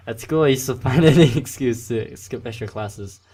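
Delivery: reading through laughter; laughing. Real, not acted. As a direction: laugh while saying it. Laughs while reading line.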